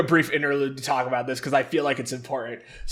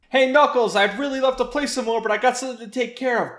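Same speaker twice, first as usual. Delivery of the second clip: put-on higher, fast cheerful kid voice; Tails voice; 7 semitones higher, faster than usual. Tails calling to Knuckles, in a high quick voice. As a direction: chirp it out quickly like an eager kid. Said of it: "Tails voice in retelling".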